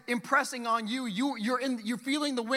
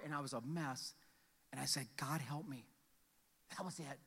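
much softer and lower; hushed confession; 9 semitones lower, 15 dB quieter than usual. Real, not acted. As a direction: share it in a near whisper. Tender retelling of his prayer.

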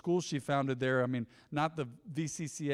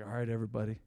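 low, soft and deflated; a dreary, bummed-out pastor's welcome; mock bummed-out pastor; 7 semitones lower, 7 dB quieter, faster than usual. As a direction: greet them like you'd rather be in bed. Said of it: "I was like".

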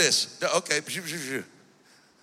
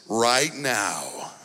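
low, smooth radio ad announcer; infomercial; 5 semitones lower than usual. Retold or imitated. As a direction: sell it like a radio ad. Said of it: "the ad you hear on the radio".

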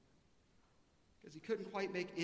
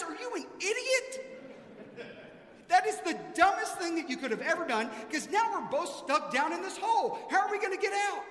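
much higher and louder, incredulous scolding; exasperated outburst; 11 semitones higher, 10 dB louder than usual. Acted. Man in a hole scolds his buddy for jumping in: much higher, louder outburst. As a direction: berate the friend for jumping in. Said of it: "And the guy says".

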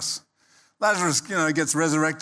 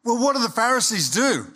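usual speaking voice

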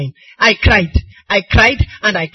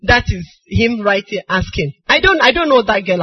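usual speaking voice

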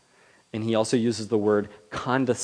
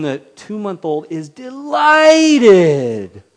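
much higher, louder and slow, cooing; delighted baby talk; 9 semitones higher, 15 dB louder, much slower than usual. Retold or imitated. Adult cooing down at a two-month-old baby, higher and louder. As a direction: coo it out like a thrilled parent. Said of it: "It's like when a".

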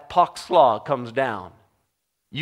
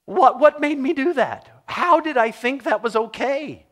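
exasperated self-scolding; 7 semitones higher, 4 dB louder than usual. Retold or imitated. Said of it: "you go".

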